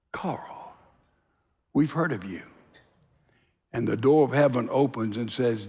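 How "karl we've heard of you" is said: low, soft, hushed welcome; gentle recognition. Acted. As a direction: acknowledge him quietly and gently. Heaven finally welcomes humbled Karl.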